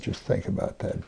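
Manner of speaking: ordinary delivery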